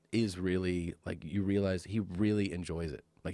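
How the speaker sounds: normal talking voice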